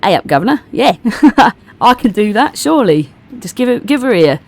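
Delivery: chirpy Cockney character voice. Acted. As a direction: put on a matey Cockney accent. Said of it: "Is that Cockney".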